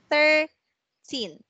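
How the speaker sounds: put-on "thirteen" slow and drawn out, falling on teen; drawn-out teacher model of "thirteen"; stress on teen. Shown as a demonstration. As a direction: lean on the end of "thirteen" slowly. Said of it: "so word stressed".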